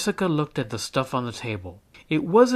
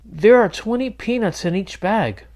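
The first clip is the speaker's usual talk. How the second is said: proud classmate; 8 semitones higher, 7 dB louder than usual. A narrator's reading. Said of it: "Bobby explained".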